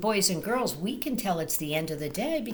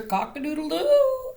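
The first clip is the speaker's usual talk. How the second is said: higher rooster crow; 8 semitones higher, 4 dB louder than usual. Acted. Reading to children, a rooster crow. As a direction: crow it out for the kids. Reading farm animal noises.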